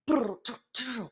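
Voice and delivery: mouthed clatter and trills; imitated fumbling noises. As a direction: mimic a muffled clatter from next room. Imitates fumble from other room.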